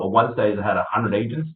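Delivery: normal talking voice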